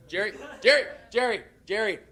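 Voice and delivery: loud, repeated call of a name. Real, not acted. Student calling to someone in the audience, calling out. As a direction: call out insistently to someone in the room.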